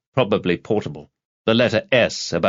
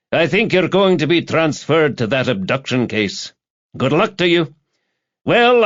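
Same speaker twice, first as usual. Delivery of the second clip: raised, lively voice of a departing colleague; breezy police colleague Campbell; 5 semitones higher than usual. Acted. Campbell breaking news to Rebus before the pub, breezy. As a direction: announce it brightly, pitched well up.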